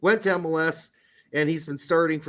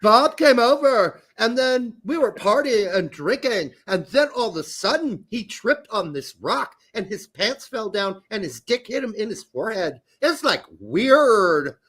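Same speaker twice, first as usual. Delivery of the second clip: put-on higher-pitched Valley Girl singsong; 5 semitones higher, 3 dB louder than usual. Mocking how young guys talk nowadays, Valley Girl accent. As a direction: gush like a Valley Girl, dragging the last word. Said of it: "talk like fucking Valley Girls".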